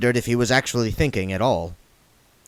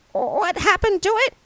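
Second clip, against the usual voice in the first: tiny high squeaky imp, apprehensive. A narrator's reading. The imp asks William about its predecessor, high and nervous. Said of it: "very apprehensively".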